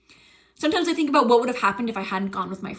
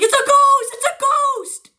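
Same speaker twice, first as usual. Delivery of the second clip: very high, panicked squeal; freaked-out shriek; 6 dB louder, slower than usual. Retold or imitated. Imitating friend who thinks it's a ghost, high panicked shriek. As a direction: shriek it twice in mock terror. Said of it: "and she freaks out".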